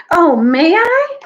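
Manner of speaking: high, loud, slow pleading then thankful; eager Gwendolyn. Acted. Gwendolyn asking the Maenads to let her dance, high eager voice. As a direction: beg to join, then gush your thanks. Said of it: "said Gwendolyn".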